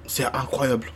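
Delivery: hushed amazed whisper. Real, not acted. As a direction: breathe it out in awe.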